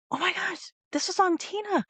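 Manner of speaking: high, teary oh my gosh, retold; reliving the moment, voice up high; tearful gasp. Retelling tearful words to husband.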